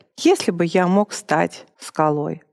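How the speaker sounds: ordinary talk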